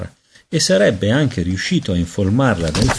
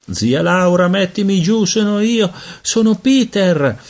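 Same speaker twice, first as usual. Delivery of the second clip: put-on much higher, slowing child's plea; pleading baby; 11 semitones higher than usual. A narrator's reading. Peter pleading with Aunt Laura to be put down, high. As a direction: beg to be put down.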